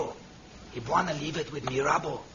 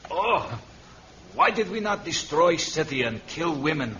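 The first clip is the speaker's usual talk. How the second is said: empört; 6 dB louder than usual. Acted. Mirabo protests after the escape.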